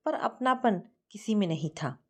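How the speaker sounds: usual speaking voice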